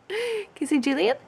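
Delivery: high-pitched, amused question after laughing; giggly prompt. Real, not acted. Parent laughing while prompting toddler to say a name, giggly.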